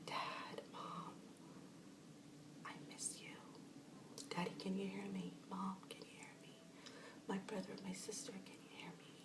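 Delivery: hushed whisper calling to departed family; whispering to late parents. Shown as a demonstration. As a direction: whisper each name like a call into the dark.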